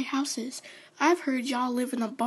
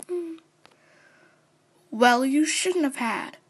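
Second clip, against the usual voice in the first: normal talking voice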